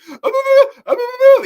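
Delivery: shrill, very high loud yelp; cartoon dog scream. Imitating Courage screaming in terror, very high and loud. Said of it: "He'd be like".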